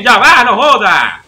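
loud slow drawn-out exasperation; exasperated outburst. Real, not acted. Streamer loses patience with attacking players. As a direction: stretch it out, fed up. Frustrated during fight.

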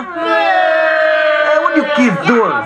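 high, fast scolding. Real, not acted. Scolding child to put shoes on.

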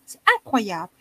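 ordinary delivery

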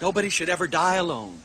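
softly, with solemn weight; hushed, grave. Real, not acted. Speaker to audience on dying alone, hushed and grave. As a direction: drop to a quiet, grave statement.

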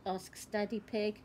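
plain reading voice

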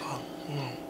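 breathy whispered trailing murmur. Real, not acted. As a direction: let it fade to a whisper.

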